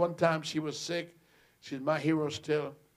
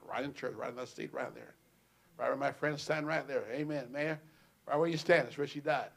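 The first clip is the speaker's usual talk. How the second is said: usual speaking voice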